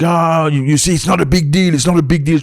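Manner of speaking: a hint of a man waving it away; dismissive guy. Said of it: "trying to play me down".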